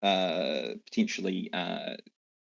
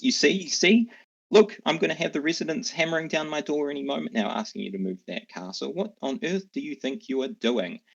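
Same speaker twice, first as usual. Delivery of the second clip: higher, louder flustered scold from the mayor; a fussy town official losing patience; mayor scolding the giant; 8 semitones higher, 4 dB louder, faster than usual. Acted. Mayor berates the storm giant over his parked castle, flustered higher scold. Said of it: "turns back to the storm giant and says".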